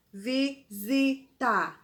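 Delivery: visita said slowly, the si buzzed like zi; slow dictation. Shown as a demonstration. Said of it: "olha o som do zi".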